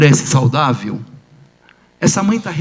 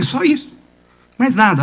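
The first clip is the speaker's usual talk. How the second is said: surprised; 7 semitones higher than usual. Retold or imitated. Doctor asks the mother, incredulous.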